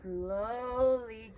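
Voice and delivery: ordinary delivery, nothing marked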